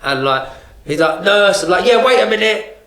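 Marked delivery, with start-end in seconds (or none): 1.2-1.6 loud, high call of "Nurse". Retold or imitated. Paralysed patient calling the nurse. 1.9-2.7 raised voice answering a call; high, loud reply. Retold or imitated. Answering the patient's call at night.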